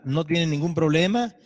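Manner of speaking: ordinary talk, nothing marked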